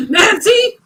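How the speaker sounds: high laughing name; Nancy, said while laughing hard. Real, not acted. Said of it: "I'm calling her Nancy".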